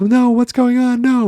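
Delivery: high mock panicked cry; trapped and terrified, crying out; panicked scream. [name] panics as the car windows close, high and loud.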